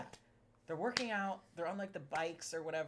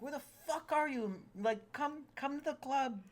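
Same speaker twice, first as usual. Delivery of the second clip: JJ calling; 10 semitones higher than usual. Retold or imitated. JJ calling Shane out, impression.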